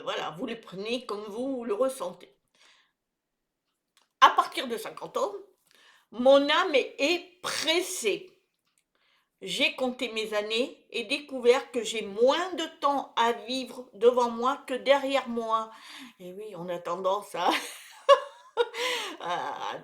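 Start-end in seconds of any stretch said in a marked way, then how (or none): 4.2-15.7 emphatic, measured poem reading with pauses; poem recitation. Acted. Sharing a poem with people over fifty, reciting emphatically. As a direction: recite each line deliberately to an audience.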